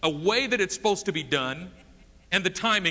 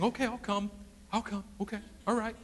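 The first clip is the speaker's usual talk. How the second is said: softer, unhurried calm promise; calm reassurance; 3 semitones higher, 8 dB quieter, faster than usual. Acted. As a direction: gently settle a panicked father. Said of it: "I can see Jesus saying".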